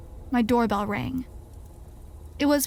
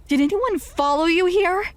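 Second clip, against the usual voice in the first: high, loud sudden alarm; 6 semitones higher, 4 dB louder than usual. A narrator's reading. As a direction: blurt it out in panic. Sudden fear of being followed.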